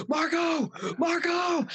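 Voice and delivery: high, urgent mock yell; retold call for help. Storyteller holding down a machete calls Marco, high mock shout. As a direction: imitate crying out for a friend.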